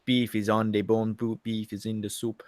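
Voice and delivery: playful put-on Jamaican patois; mock Jamaican accent. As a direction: do a rough Jamaican impersonation. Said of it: "I know Jamaican really well".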